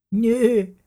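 put-on high, smug little girls' voice; cheeky sisters grinning at their brother. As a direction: tease him, grinning. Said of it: "grinsten Paules Schwestern".

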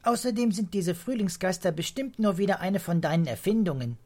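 put-on high, insistent child's voice; accusing child. A narrator's reading. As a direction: challenge his story like a sceptical kid. Pia challenges grandpa's tale.

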